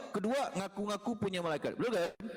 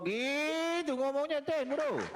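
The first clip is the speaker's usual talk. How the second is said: high scornful jeer; 9 semitones higher, 3 dB louder, slower than usual. Real, not acted. Scolding the audience.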